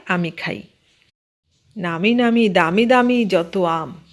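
usual speaking voice

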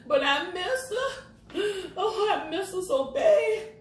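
tearful laugh about missing her daughter; laughing cry. Real, not acted.

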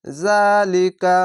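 জালিকা recited very slowly, the লা held one alif long; Quranic recitation. Shown as a demonstration. Teacher models a word for Quran readers, stretched. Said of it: "খাড়া জবর এক আলিফ টেনে পড়বেন".

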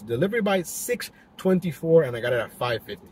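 ordinary delivery

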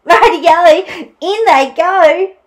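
high, loud gleeful call; jaunty dingo catchphrase; cheering Dingo. Acted. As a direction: sing out the go-ahead.

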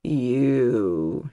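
low hoarse croak; croaking dead voice. Acted. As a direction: croak it like a corpse. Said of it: "she croaked".

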